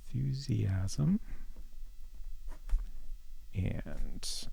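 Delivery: whispering the words slowly while typing; whispered dictation. Real, not acted. Streamer whispers the advice while typing it in. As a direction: breathe each word out as you type it. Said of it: "Exclamation point".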